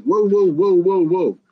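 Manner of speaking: mock whoa. Biden cutting off the Afghan president, loud whoa. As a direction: halt him with a loud whoa. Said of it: "And Biden said".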